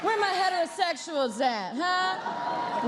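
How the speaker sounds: rally the audience with a playful shout-out; crowd call-out. Pretend. Host hyping up the straight people in the audience, playful call-out.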